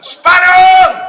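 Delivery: loud, high showman's shout; shouted cue. Real, not acted. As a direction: shout the drum-roll cue hard. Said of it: "Ich schreie Spannung".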